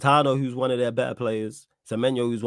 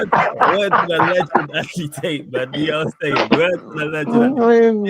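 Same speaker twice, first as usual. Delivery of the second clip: mock shoutout; 6 semitones higher, 6 dB louder than usual. Hyping a legend in put-on voice.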